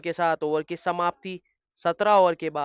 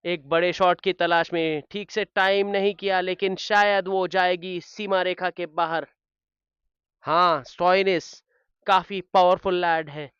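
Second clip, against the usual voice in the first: excited cricket commentary; 2 semitones higher, 6 dB louder than usual. Acted. Live play-by-play of a big shot.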